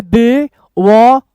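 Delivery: slow, broken into separate syllables. Shown as a demonstration. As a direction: sound out each syllable on its own. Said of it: "तोड़ तोड़ के एक एक अक्षर बताऊंगा".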